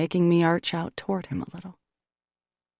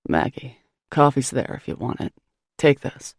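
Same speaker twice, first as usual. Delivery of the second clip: low, terse man reading a note; a hint of a gruff man; 5 semitones lower than usual.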